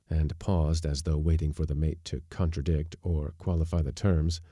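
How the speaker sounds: ordinary delivery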